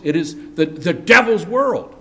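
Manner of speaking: usual speaking voice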